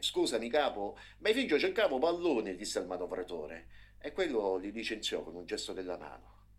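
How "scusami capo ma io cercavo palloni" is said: high, loud mocking remark; mock the boy to the boss; sarcastic jibe. A narrator's reading. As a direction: bulldozer driver sneering to his boss.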